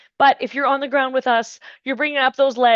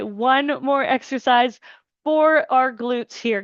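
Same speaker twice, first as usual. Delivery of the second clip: ordinary talk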